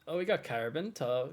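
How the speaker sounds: ordinary delivery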